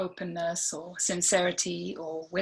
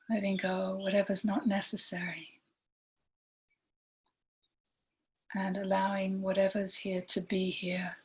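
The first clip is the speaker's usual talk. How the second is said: guided meditation; 3 dB quieter than usual. Real, not acted. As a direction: lead gently through it, leaving long silences between phrases.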